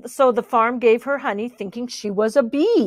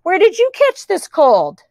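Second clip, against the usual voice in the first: high-pitched demanding question; accusing animals; 7 semitones higher, 4 dB louder than usual. A narrator's reading. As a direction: interrogate in a raised character voice. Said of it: "in their mixed-up voices".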